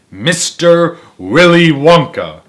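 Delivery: slow, grand chapter title; a grand, drawn-out title; excited announcement. Real, not acted. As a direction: savour the name with delight. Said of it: "Got excited".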